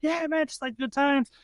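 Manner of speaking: high-pitched cheerful bro voice; mock party bros. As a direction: ham up the carefree guys cheering you on. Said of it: "the guys are like".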